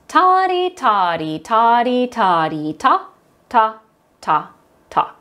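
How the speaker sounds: up-and-down chant on a steady beat; rhythm syllables. Shown as a demonstration. Teacher leads the second line, chanting rhythm. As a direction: chant the pairs, then spaced single beats. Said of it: "speak on rhythm syllables".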